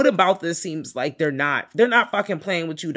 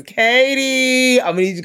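mock calling out; 8 semitones higher, 6 dB louder than usual. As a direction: belt the name out as an imitation. Acting out who Katie is.

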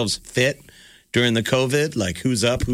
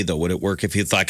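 normal talking voice

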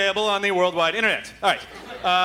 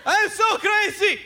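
much higher, slow mock pitchman voice; Crazy Gideon; 12 semitones higher, slower than usual. Comedian mocking a local appliance ad, high hammed-up pitchman. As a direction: ham up a TV huckster bragging he's crazy. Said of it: "trying to tell you how crazy he is".

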